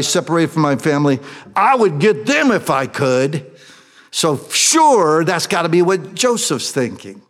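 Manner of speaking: high, loud pulpit fervor; fervent preacher retelling the brothers' plea; impassioned preaching. Acted. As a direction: preach it out with full force. Preacher rising to full force.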